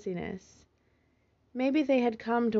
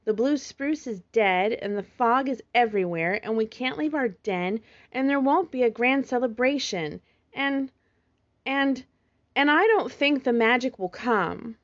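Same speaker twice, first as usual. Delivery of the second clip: louder, tumbling list of worries; upset outburst; 5 semitones higher, 6 dB louder than usual. A narrator's reading. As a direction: let it all spill out in a rising rush. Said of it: "Cosmo cried".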